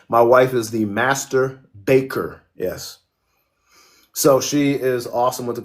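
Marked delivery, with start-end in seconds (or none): 0.1-2.4 slow, deliberate, every word spaced out; slow deliberate punchline; said slowly. Shown as a demonstration. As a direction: spell out "My wife is the master baker" slowly for the joke. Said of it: "let me say that slowly".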